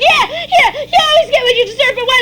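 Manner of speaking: high, loud, rapid retort; defiant yelling. Acted. Child talking back during a beating, defiant yell. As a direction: yell back fast, pitch way up.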